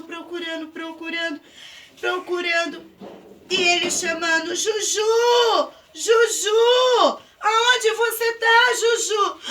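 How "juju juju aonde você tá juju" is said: high, loud desperate call. Acted. Pedro calls his lost sheep, shouting desperately.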